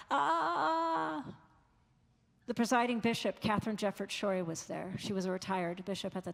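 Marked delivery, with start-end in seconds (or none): 0.1-1.2 high crying wail; a wordless cry that cuts off, pitched high; answering scream. Retold or imitated. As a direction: parents crying back over the wall, high and pained. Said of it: "they screamed back".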